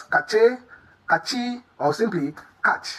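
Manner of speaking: cache as ka-CHAY, cachi as ka-CHEE, then catch; common mispronunciations. Shown as a demonstration. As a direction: list wrong takes of cache with a hard "ch": cache, cachi, catch. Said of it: "heard people pronounce this as".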